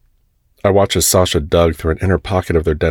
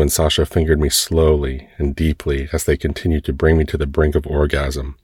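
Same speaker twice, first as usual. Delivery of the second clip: plain reading voice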